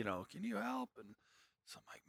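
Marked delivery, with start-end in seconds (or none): 0.3-0.9 high, soft mock cry for help; imitated distant yelling. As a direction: imitate a stranded guy hollering from far away. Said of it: "I can hear a guy yelling".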